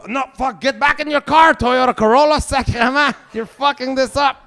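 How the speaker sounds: high, loud furious yell; radio host screaming at a Corolla driver. Pretend. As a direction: scream at the driver who blew it. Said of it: "And he's like".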